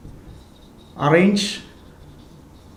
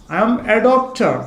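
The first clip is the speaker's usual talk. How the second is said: "I am a doctor" drawn out word by word, very slow; dictating at a crawl; slow dictation; 5 semitones higher, much slower than usual. Shown as a demonstration. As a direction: spell it out slowly for the class to copy. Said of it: "teacher repeats it slowly for dictation".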